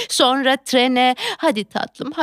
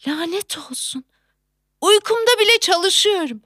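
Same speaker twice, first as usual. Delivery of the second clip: usual speaking voice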